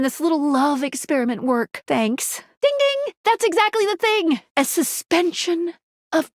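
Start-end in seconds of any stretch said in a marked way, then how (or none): 2.6-4.4 high, bright-eyed cheer of a right answer; delighted, high-pitched confirmation; excited. Acted. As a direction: chime it high like a game-show bell, then exclaim.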